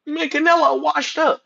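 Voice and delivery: high, slow, put-on doubter voice; drawn out, scoffing imitation of critics; mocking the doubters. Streamer mimicking the world dismissing Canelo, high and slow. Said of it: "the world gonna make it seem like".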